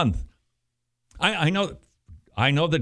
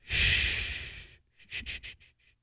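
long unvoiced shushing, hushing the pastor; mock shushing; 9 dB quieter than usual. Critics hushing the pastor, long whispered shush. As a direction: shush them down like a librarian. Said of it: "a very loud voice saying".

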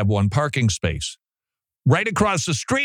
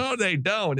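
ordinary talk, nothing marked

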